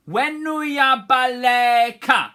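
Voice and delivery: slow, high, loud call of Wenuya baleka; leading the class. Shown as a demonstration. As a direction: call it out to repeat.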